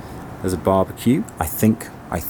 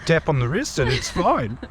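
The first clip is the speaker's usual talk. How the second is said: mock Canadian accent; lightly put-on Canadian voice; 6 semitones higher than usual. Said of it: "terrible Canadian accent".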